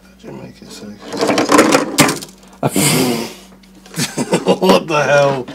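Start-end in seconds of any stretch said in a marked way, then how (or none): 0.9-4.5 a sudden burst of loud laughter. Real, not acted. Vlogger surprised by his fan lights, loud laugh.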